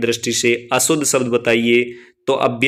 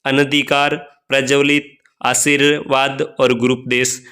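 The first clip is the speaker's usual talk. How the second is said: ordinary delivery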